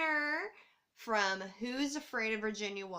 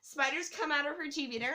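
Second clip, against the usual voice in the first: usual speaking voice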